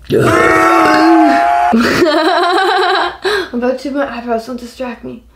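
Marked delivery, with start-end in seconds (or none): 0.2-3.5 loud high cackling laugh. Real, not acted. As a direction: shriek with laughter, near crying. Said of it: "Call of Duty zombie".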